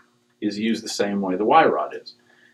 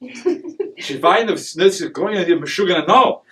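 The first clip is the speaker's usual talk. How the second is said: mock German; 7 semitones higher, slower than usual.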